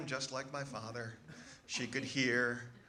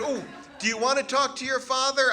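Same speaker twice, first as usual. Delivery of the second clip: much louder and higher call; 11 semitones higher, 9 dB louder than usual. Retold or imitated. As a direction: call across the room, bright and loud. Mother calling, passing phone.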